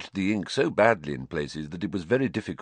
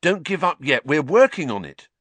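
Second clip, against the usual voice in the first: louder, higher, urging; fierce; 7 semitones higher, 5 dB louder than usual. Acted. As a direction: refuse to let him give up. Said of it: "said Ron fiercely".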